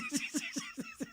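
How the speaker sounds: high wheezy laugh. Real, not acted. Co-host laughing at her own remark, high giggling. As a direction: burst of high, soft laughter.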